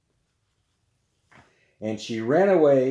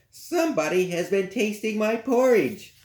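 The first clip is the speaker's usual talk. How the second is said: much higher mama bear voice; middle-sized bear; 11 semitones higher than usual. Acted. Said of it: "said the middle-sized bear".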